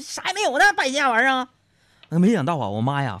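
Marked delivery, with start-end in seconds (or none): 0.0-1.5 very high, mock-furious scolding; thundering mother. Mother to son back home broke, hammed-up rage. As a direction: blow up at your wastrel child. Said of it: "妈妈会大发雷霆".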